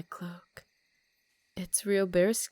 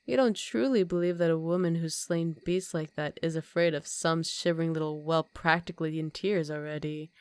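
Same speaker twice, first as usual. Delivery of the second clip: ordinary delivery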